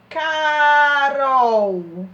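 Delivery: Carol loud, the final L sounded as U; demonstrating final L. Shown as a demonstration. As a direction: ring out Carol to show the U ending. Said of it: "Ela tem som de U".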